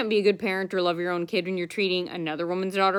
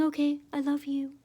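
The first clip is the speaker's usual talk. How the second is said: mock sweet; 6 semitones higher, 5 dB quieter than usual. Mocking coworker's fake sweetness.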